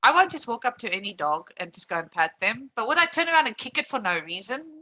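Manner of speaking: ordinary talk, nothing marked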